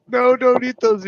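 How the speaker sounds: high, loud mock alarm; hammed-up urgent shout; retold frantic warning. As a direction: yell a warning as if retelling it.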